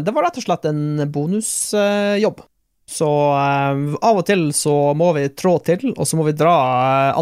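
ordinary talk, nothing marked

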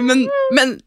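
ordinary talk, nothing marked

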